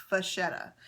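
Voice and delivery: French-style "vachetta", ch as in vache; French pronunciation. Shown as a demonstration. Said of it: "Although I took French in high school".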